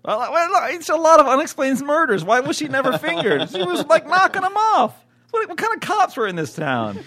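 very high, incredulous squeal; mock outrage. Host rants about small-town murders, in high-pitched mock outrage. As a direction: rant in a soaring, outraged pitch.

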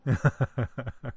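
short run of chuckles; amused chuckle. Real, not acted. Responding to the co-reader's explanation, amused chuckle. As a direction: chuckle along at ordinary volume. Amused reply about reading aloud.

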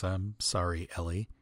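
ordinary talk, nothing marked

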